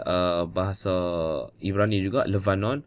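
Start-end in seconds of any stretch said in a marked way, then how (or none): none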